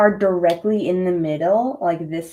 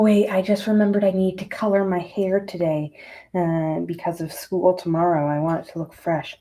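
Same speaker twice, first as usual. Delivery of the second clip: normal talking voice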